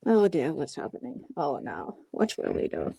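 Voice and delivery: a hint of a fussing grandmother; low mock-dismayed old lady voice; mock fretful granny. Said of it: "I sound like a grandmother".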